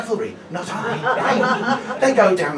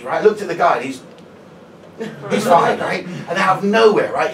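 ordinary delivery

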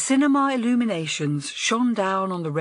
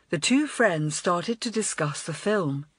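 plain reading voice

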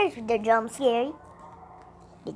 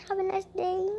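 soft goodbye; 3 semitones higher, 8 dB quieter than usual. Real, not acted. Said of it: "repeated louder".

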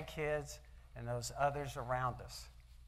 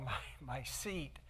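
ordinary delivery

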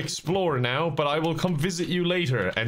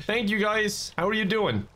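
normal talking voice

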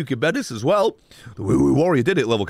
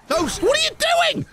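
shouting. Real, not acted. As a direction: yell in disbelief. Yelling at the game.

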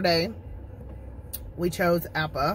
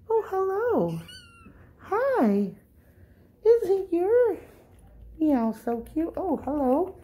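pet talk; 11 semitones higher, slower than usual. Real, not acted. Owner greets the shelter cats in high baby talk. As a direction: gush at the kitten sweetly.

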